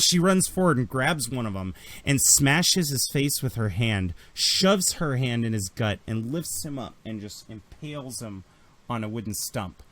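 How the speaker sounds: ordinary talk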